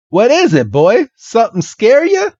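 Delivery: put-on high, teasing Southern accent; hammed-up Southern country fella; Bill. Bill questioning a stammering Red, Southern accent. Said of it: "this Southern accent".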